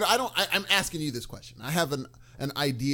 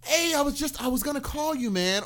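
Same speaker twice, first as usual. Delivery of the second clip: gushing; 9 semitones higher than usual. Retold or imitated. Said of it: "Do you prefer the gushing".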